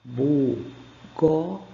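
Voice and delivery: "Bugosa" very slowly, one syllable at a time; "Bugosa" sounded out as bu, go, za. Shown as a demonstration. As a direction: leave gaps between the syllables of "Bugosa". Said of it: "Lê em voz alta".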